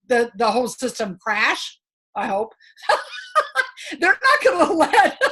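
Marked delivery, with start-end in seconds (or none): 2.9-5.3 laughing. Real, not acted. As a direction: talk through your own laughter, pitch jumping up. Laughs at own doubtful hope.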